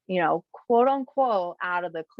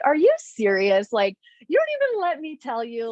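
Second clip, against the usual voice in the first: higher, indignant retold protest; mock exasperation; 7 semitones higher, 4 dB louder than usual. Retelling daughter to mom who guessed first, mock indignant. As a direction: protest like a thwarted daughter, playfully.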